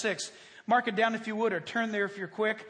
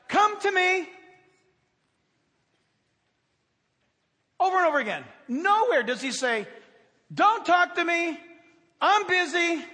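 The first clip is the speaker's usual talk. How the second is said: high, loud fervent sermon voice; preaching at full force; 6 semitones higher, 6 dB louder than usual. Acted. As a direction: drive the point home to the congregation.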